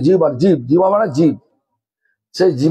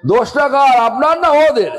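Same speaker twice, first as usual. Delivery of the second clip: emphatic raised question; 6 semitones higher than usual. Real, not acted. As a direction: hammer the question home to the listeners. Preacher repeats question, raised emphatically.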